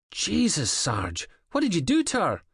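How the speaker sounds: high, shocked accusation; shocked outburst. A narrator's reading. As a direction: recoil at the blood. Officer shocked at bloody scene.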